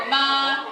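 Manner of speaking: "ma" held long on an even high pitch; level tone. Shown as a demonstration. Teacher adding tones to the drill, level tone. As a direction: sustain "ma" without a glide. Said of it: "Can everyone say ma?".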